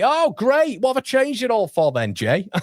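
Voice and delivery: high, loud jokey protest; mock exasperation. Real, not acted. Host protesting to viewer Jay about the changed feed, mock exasperated. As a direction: cry out in exasperation, pitch leaping up.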